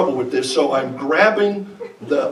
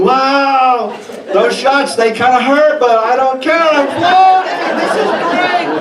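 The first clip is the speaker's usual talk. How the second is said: giddy high-on-gas thoughts; 7 semitones higher than usual. Retold or imitated. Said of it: "I'm thinking".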